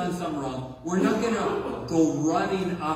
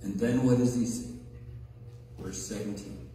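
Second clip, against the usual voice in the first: ordinary delivery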